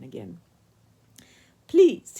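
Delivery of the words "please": high, loud, pleading call across the water; despairing call. A narrator's reading. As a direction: call out one last desperate plea. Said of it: "he called, making one last despairing effort".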